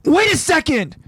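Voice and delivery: high-pitched urgent yell; acted frantic shout. A narrator's reading. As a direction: yell to stop someone. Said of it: "stops the scout by yelling out".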